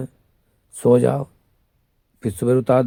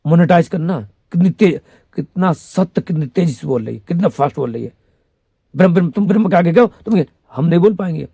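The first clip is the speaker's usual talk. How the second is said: loud, high and fast, carried away with praise; excited gushing; 6 semitones higher, 6 dB louder than usual. Real, not acted. Praising the woman's rapid speech, loud excited gush. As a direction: rave about how fast she talks.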